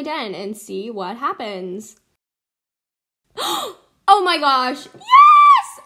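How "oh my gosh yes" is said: gasp, then build to a high scream; real thrilled yell; excited shout. Real, not acted. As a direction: erupt in loud, shrieking triumph. Someone answered the silly advert.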